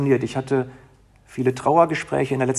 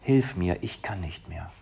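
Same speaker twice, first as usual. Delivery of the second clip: weary prayer; 5 semitones lower, 6 dB quieter than usual. Acted. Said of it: "sag ihm".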